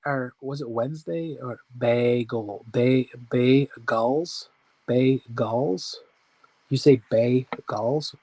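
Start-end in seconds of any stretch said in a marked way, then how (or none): 1.8-6.0 bagel drawn out as two slow beats, "bay-gulls"; sounding out bagels. Real, not acted. Host testing how bagels sounds mid-story, sounded out slowly. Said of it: "making me sound out words". 7.1-8.1 bagels split slowly into "bay-gulls", rising; sounding out bagels. Real, not acted. Host asking viewers if bagels sounds funny, sounded out slowly. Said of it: "Am I saying it funny?".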